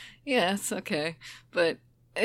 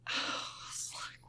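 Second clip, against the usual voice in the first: whispered; 12 dB quieter than usual. Real, not acted. Whispered aside.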